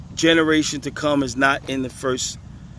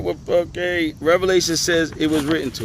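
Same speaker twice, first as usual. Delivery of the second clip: ordinary talk, nothing marked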